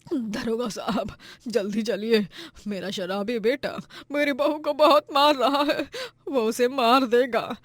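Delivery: pleading that climbs into crying; tearful pleading. Acted. Mother begging police officer to save daughter-in-law, tearful plea. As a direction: beg desperately, building to a high wail.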